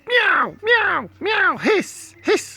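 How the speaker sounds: very high meows and hisses; shrieking cats. Acted. Cats meowing at the intruders. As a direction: screech like angry cats.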